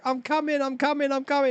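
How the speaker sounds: high, hurried reply; high-pitched mock reassurance on the way; friend answering. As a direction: call back in a high voice. Retold advert, friend answering.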